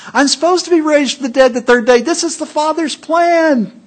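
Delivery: high, loud and fast; fervent declaration; impassioned insistence. Acted. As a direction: press the point with rising fervour. Jesus insisting on his plan.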